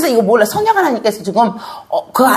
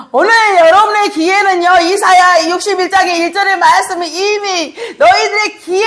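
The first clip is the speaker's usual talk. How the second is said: raised, higher preaching voice pressing the point; fervent preaching; 8 semitones higher than usual. Acted. Pastor urging the congregation about the gospel, fervent preaching. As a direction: preach it out to the congregation, full force.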